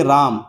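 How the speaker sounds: राम with a long, held AA; stretched vowel. Shown as a demonstration. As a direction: draw राम out. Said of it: "तो खींचना पड़ेगा".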